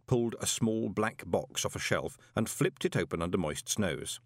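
plain reading voice

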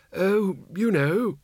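high, slow character voice; feeble Windle. A narrator's reading. Battered Windle answers comrade.

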